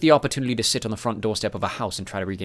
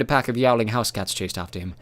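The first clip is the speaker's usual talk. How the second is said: ordinary delivery, nothing marked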